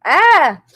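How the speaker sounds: high, loud squeal of joy; excited scream. Real, not acted. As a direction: shriek with happy shock. Huge gift, overwhelmed.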